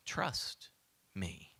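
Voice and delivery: soft, breathy hush of God answering; hushed divine voice. Acted. God answering Moses's doubts, hushed. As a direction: let it fall to a near whisper. Preacher voices God's reply.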